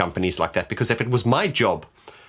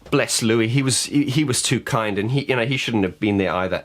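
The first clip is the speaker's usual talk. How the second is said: usual speaking voice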